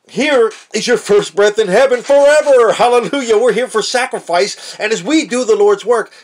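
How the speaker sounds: preacher soaring on heaven and sacrifice. Acted. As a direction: lift it up into a shouted praise. Preaching peak, gasp, shouts hallelujah.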